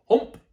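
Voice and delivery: big loud swallowing gulp. Acted. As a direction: gulp it all down in one. Tiger gulps sandwiches.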